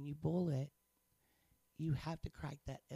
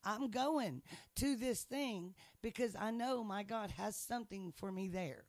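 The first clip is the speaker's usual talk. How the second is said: defiant scolding; 6 semitones higher, 4 dB louder than usual. Retold or imitated. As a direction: stand up to the devil, sharply. Telling off the devil.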